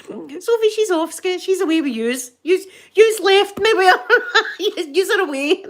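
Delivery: high, laughing complaint; laughing protest. Real, not acted. Left behind with the woman, laughing exasperated protest. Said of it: "laughing, left with the woman".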